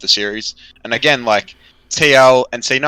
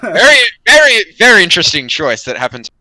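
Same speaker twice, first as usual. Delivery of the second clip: high, loud, sarcastic emphasis; 10 semitones higher, 8 dB louder than usual. Real, not acted. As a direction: drip sarcasm on very interesting. Sarcastic about bad draft.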